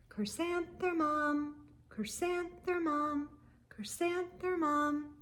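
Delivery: hushed, lilting call of the flowers; gentle, dreamy whispering flowers; soft flower voices. A narrator's reading. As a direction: let the flowers murmur her name softly. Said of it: "the flowers seemed to say".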